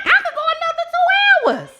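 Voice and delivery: high, rushed, giddy protest; mock eager. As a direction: blurt it out like you don't want the fun to end. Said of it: "I'm like".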